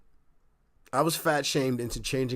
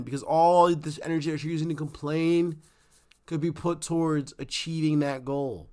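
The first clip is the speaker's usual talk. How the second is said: ordinary talk, nothing marked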